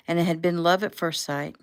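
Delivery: usual speaking voice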